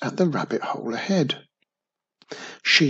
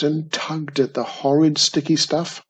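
plain reading voice

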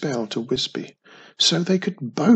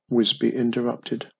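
ordinary delivery

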